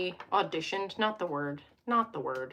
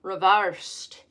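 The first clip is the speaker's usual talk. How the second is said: reversed with a broad open ar vowel, rev-AR-sed in a light imitated accent; Alex's accent; 6 dB louder than usual. Imitating a favourite tarot creator's accent. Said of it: "her accent".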